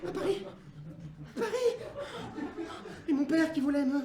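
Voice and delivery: voix aiguë, riant à demi; délire d'enfant choqué; enfant hébété. Acted. Isaac fou répète. (Isaac répète, voix aiguë et riante)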